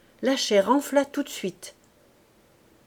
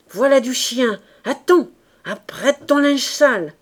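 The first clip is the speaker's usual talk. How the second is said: loud, raised fighting threat; changed, rasping voice; 4 semitones higher, 7 dB louder than usual. A narrator's reading. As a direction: shout a challenge in a rasp. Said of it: "Elle râlait, la voix changée". (menace criée, voix changée)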